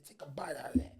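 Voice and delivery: low, whispered imitation of a furious dad; hushed threat. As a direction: order it under your breath, menacingly. Shaking father threatens with soap.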